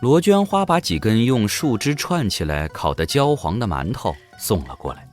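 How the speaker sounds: ordinary delivery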